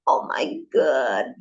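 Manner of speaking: slow, pained yelp at a hair pulled out; hammed-up ouch; mock cry of pain. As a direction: mimic a drawn-out wail of pain. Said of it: "Dia tarik".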